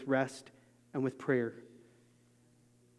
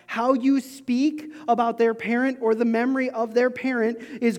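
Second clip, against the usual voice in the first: raised preaching voice, slowed on key lines; 6 semitones higher than usual. Acted. Pastor urging parents, in a raised preaching voice. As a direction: press the point home to the church.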